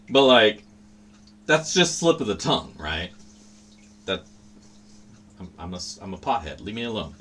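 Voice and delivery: normal talking voice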